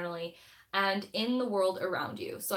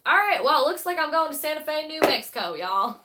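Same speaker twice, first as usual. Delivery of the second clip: loud, higher-pitched mock Southern accent; put-on twangy accent; 8 semitones higher, 10 dB louder than usual. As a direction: belt it out in a playful y'all accent. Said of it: "I don't know why I did that accent".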